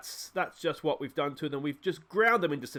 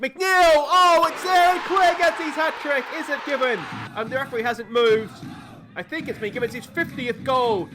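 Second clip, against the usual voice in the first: much higher and louder, yelling in delight; hat-trick goal shout; 8 semitones higher, 7 dB louder than usual. Real, not acted. Celebrating Clare's hat-trick for viewers, delighted shout. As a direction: scream it as the hat-trick lands.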